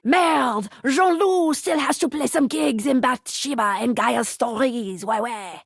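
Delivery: put-on exclamation, then a French-accented game line. Game narrator reporting on Jean-Loup's gigs, French accent.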